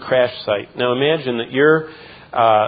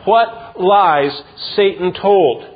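higher and louder, slowing on the lies line; preaching at full force; 6 semitones higher, 4 dB louder than usual. Acted. Pastor exposing Satan's lies, preaching at full force. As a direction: build it up from the pulpit.

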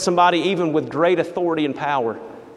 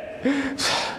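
high, short huffing sigh; a petulant exhale; sulky sigh; 7 semitones higher, 8 dB quieter than usual. Acted. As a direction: huff like a pouting child. Ahab sulks.

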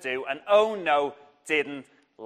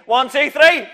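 shouted count; 8 semitones higher, 10 dB louder than usual. Shown as a demonstration. Teacher cueing the hall to shout, loud count. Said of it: "You ready?".

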